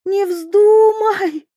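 high, slow cry, voice cracking; voice breaking. A narrator's reading. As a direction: let the voice crack on вздумай. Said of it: "Голос принцессы сорвался".

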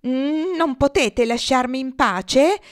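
loud, slow exasperated; fed up, snap back; the lead goose exasperated with the flock. A narrator's reading. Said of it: "più seccata che mai".

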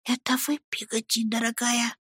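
put-on very soft, high boyish whisper; whispered question. A narrator's reading. Boy whispering to his nanny through a keyhole, hushed question. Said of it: "прошептал".

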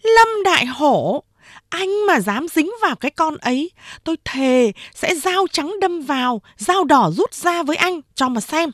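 high-pitched angry woman; angry threat. A narrator's reading. Hứa Yến threatens Đại Hổ.

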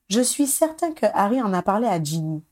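plain reading voice

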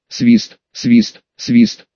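low processed swishing effect; distorted swooshes, deep and far; deep distorted whoosh. A narrator's reading. Звук рассекающего оружия. (низкий искажённый свист)

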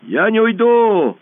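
high, loud, slow refusal; boy refusing to leave; defiant shout. A narrator's reading. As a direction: stand your ground. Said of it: "ответил Пашка".

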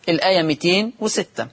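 ordinary delivery

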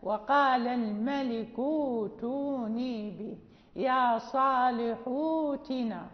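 وقال الملك ائتوني به recited slowly, the vowels held long; recited examples. Shown as a demonstration. Teacher repeating the verses slowly for the students. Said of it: "ها".

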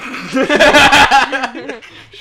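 high burst of laughter; loud laugh. Real, not acted. Burst of laughter at own mistake.

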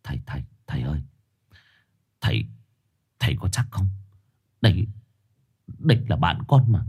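quiet, shaky frightened plea; trembling. Acted. Sinh pleading with the shaman, trembling. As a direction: stutter it out in fear. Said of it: "run rẩy".

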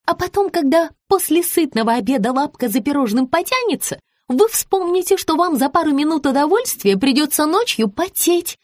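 high-pitched, chirpy explanation; objecting Rita. A narrator's reading. Rita justifies night squats to her client, objecting. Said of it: "Маргарита возразила".

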